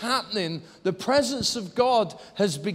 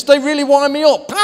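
higher, louder mimicked complaint; worked-up retold quote; 9 semitones higher, 9 dB louder than usual. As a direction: act out his agitated outburst. Retold man erupting with joy.

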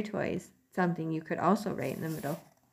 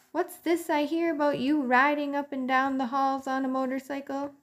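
higher, teasing uncle mouse; 8 semitones higher than usual. Acted. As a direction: poke fun at the nephew. Uncle Lester teasing Ralph.

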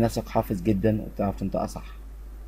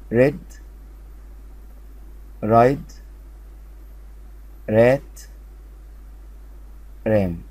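words called out louder, long pauses to echo; loud drill; slower than usual. Shown as a demonstration. Teacher reads the column with pupils, loud and clear. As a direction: lead the class through the column, loud. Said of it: "مرة أخيرة".

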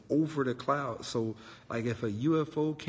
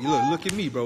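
much higher, louder frantic plea; friend P voice; 8 semitones higher, 7 dB louder, faster than usual. Retold or imitated. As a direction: squeal it like a panicked friend.

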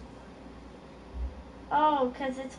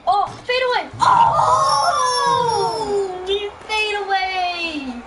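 loud, high play-call shout; excited shout; 6 semitones higher, 7 dB louder, slower than usual. Real, not acted. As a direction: yell it like a highlight call. Calling a fadeaway shot.

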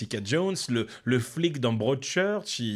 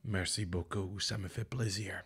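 low, soft French in a mock English-Belgian accent; imitated English-Belgian accent; 4 semitones lower, 9 dB quieter, slower than usual. Retelling Laurent's polite thanks in French, imitated English-Belgian accent. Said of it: "Avec un accent anglais belge?".

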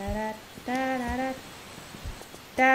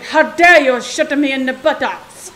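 mock indignant; 2 semitones higher, 12 dB louder than usual. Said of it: "It's like".

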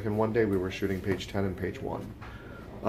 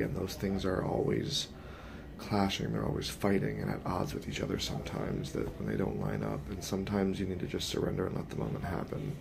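real self-doubt; 8 dB quieter than usual. Real, not acted. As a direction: admit it quietly, pausing to find words. Confesses self-doubt about the performance.

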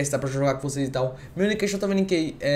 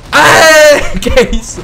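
excited shout; 9 semitones higher, 14 dB louder, much slower than usual. Real, not acted. Celebrating a kill in game.

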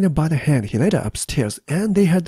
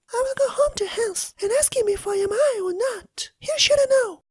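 crying Elsa. Acted. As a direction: whimper it out, close to tears. Elsa decides.

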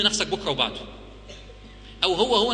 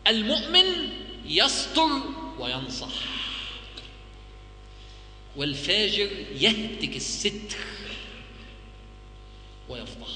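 slow, weighty recitation; slow solemn quote; 4 dB quieter, slower than usual. Retold or imitated. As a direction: let each word land slowly. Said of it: "اسمع كلمة الفضيل بن عياض". (تلاوة بطيئة رزينة)

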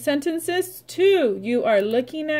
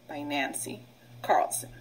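soft unvoiced whisper of the author's name; 7 semitones lower, 9 dB quieter than usual. Shown as a demonstration. Teacher whispering the author's name to her class. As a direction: breathe the byline out like a secret.

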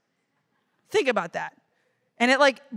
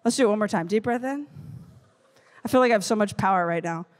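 ordinary talk

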